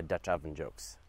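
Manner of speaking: ordinary talk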